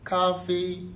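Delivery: "Café" held high and level; both syllables of "Café" on one high steady pitch; first-tone demo. Shown as a demonstration. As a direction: sustain "Café" high without falling. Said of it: "Premier ton, premier ton".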